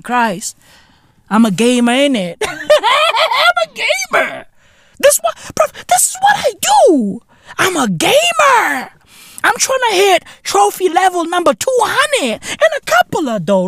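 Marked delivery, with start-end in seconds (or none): 2.4-4.5 high, drawn-out giggling boast; laughing squeal. Acted. Comedian bragging about trophy level, high laughing squeal. 5.0-13.3 high-pitched, excited boasting; squealed bragging. Acted. Gamer bragging about his trophy level, high squealed boast.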